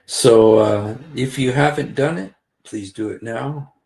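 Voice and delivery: normal talking voice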